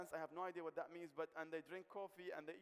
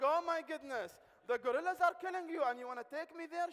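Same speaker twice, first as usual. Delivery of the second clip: higher and louder, mock panic; mock alarmed shout; 9 semitones higher, 11 dB louder than usual. Ali alarmed by the news.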